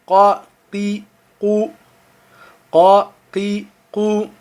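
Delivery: Qa, qi, qu tirés du fond de la gorge; qaf guttural. Shown as a demonstration. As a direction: faire venir le qaf de la gorge. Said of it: "du fond de la gorge". (qaf profond, du fond de la gorge)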